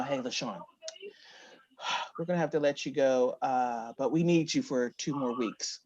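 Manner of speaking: low, soft apologetic delivery; sheepish boss. Retold or imitated. Boss softly letting LaShawn go over Zoom. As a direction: break the news gently and quietly. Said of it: "They put me on a Zoom call".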